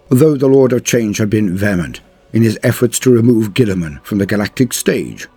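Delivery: ordinary delivery, nothing marked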